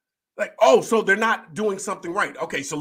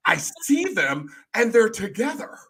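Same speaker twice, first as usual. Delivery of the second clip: slow, raised, scandalized imitation; mock Karen; 5 semitones higher than usual. Coach mimics host judging young churchgoers, mock-scandalized. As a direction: draw it out with exaggerated disapproval.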